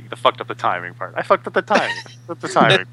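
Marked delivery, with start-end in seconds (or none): 1.2-2.9 higher-pitched put-on quote voice; quoted film line.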